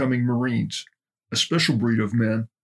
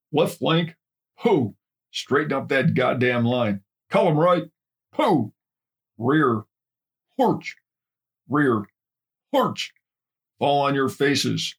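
drill commands; 3 semitones higher, slower than usual. Acted. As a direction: sing out marching commands. Drill instructor calling cadence commands.

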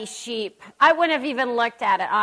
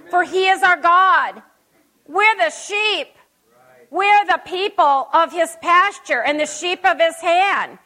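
rising preaching fervor; 5 semitones higher, 6 dB louder than usual. Real, not acted. Preaching climax on the psalm.

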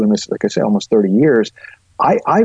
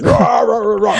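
mock rage growl; 11 semitones higher than usual. Host acting out the wife's reactive inner voice, mock roaring. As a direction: blurt wordless fury like an inner beast. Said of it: "she overrides the voice and she says".

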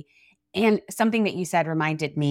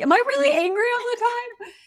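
high-pitched mock dismay; stricken disbelief. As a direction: squeak it out like a wounded mother. Mother acts out her hurt reaction.

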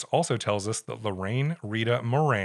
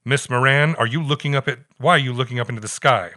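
louder, mock-stern nun voice; a hint of a scolding nun; 3 semitones higher, 7 dB louder than usual. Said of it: "said in her usual stern way".

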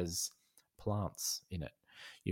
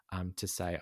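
ordinary delivery

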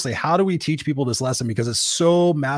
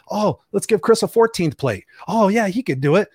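higher-pitched, breezy imitation of colleagues; mock eager delegating; 6 semitones higher, 4 dB louder than usual. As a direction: casually pile it on, mockingly. Voicing bosses piling work on him.

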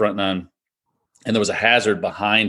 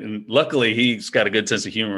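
ordinary talk, nothing marked

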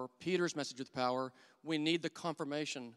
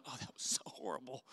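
whispered to himself, much softer; mortified whisper; 8 dB quieter than usual. Retold or imitated. Teacher whispering to himself after the awkward prayer, mortified whisper. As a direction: breathe it out like you can't believe what you did.

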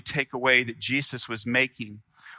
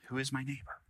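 softer repeat; 2 semitones higher, 11 dB quieter, faster than usual. Shown as a demonstration. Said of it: "Is the question God is asking you today".

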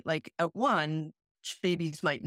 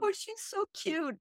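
cooing; 4 dB quieter than usual. Retold or imitated. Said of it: "I was like".

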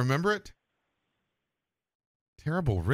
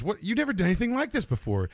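incredulous dog owner; 11 semitones higher than usual. Acted. Owner's disbelief at dog in joke.

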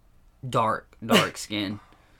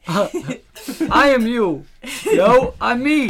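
silly voice; 13 semitones higher, 12 dB louder, much slower than usual. Pretend. Silly identity bit, squeaky voice.

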